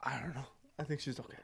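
very soft, whispered alien mimicry; eerie whispered Predator echo. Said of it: "I can do the Predator".

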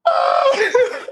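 high-pitched drawn-out "oh" breaking into laughter; hammed-up high cry, laughing; acted overwhelmed cry. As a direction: wail it in comic disbelief. Reenacting relief at being let go.

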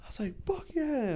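retold overjoyed cheer; elated exit. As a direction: squeal it walking out. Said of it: "cursing as I'm walking out".

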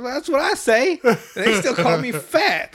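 high, loud cartoon-kid protest; mock indignant complaint. Retold Cartman complains to mom about school, hammed-up indignant protest.

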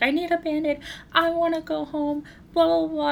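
put-on whiny child. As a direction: mimic a five-year-old begging to go home. He was like.

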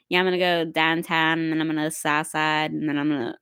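put-on low, mumbled Pittsburgh accent; mock Pittsburgh accent. Outsider teasing locals about not enunciating, mock Pittsburgh accent. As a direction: imitate a Pittsburgher running the words together. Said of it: "But you guys are like".

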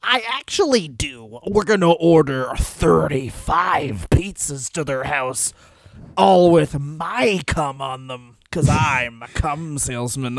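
slow, scheming promoter voice; Paul Heyman. Acted. Promoter reveals his revenge plan to an ally, grandly vengeful. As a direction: relish the petty revenge plan, drawn out. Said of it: "He's like, Paul".

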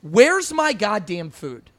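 slow, loud demanding customer; impatient customer. Retold or imitated. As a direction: bark like a hangry guest. Bartender voicing impatient customer.

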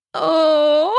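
high, drawn-out moan; moaned gasp, pitched well up; gasping groan. Acted. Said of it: "I gasp and groan".

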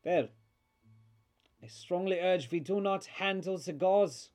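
put-on high, emphatic accented voice; grave accented doctor. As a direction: warn firmly as a foreign doctor. Game master voices accented doctor.

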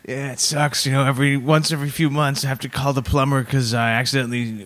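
low, put-on voice of a bragging complainer; imagined humble-bragger. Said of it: "I'm imagining someone like".

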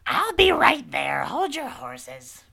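very high, loud, drawn-out yell; witch hollering back. Acted. Witch answering her twin inside, slow high holler. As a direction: call impatiently across the house. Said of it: "She goes".

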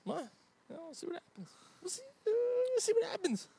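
high squeaky singsong; falsetto. Pretend. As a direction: squeak it out coyly. Mock coy dodge.

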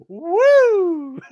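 long whoop after a laughing fit; high, loud whoop. Real, not acted. Joke teller recovering from his own joke, whooping. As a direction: let out a big, high woo.

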